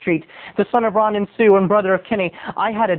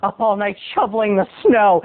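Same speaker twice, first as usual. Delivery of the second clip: usual speaking voice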